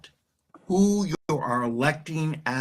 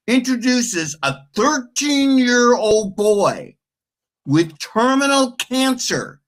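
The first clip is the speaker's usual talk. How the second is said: angry; 6 semitones higher, 5 dB louder, slower than usual. Real, not acted. Ranting about politicians not standing.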